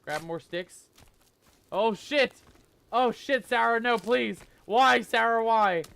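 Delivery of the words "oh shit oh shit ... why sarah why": loud, high shocked cries; jump-scared. Real, not acted.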